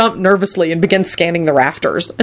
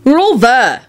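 high, raised voice; loud announcement; 8 semitones higher, 4 dB louder, slower than usual. A narrator's reading. George announcing the roll to the meeting, loud. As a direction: call it out to the room. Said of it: "George announced loudly".

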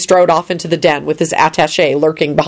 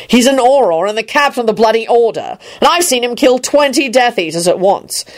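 higher, emphatic telling-off; exasperated bellow; 6 semitones higher than usual. A narrator's reading. Kingsley berates the guard about Lupin, bellowing. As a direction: set the fool straight, worked up. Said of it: "Kingsley bellowed".

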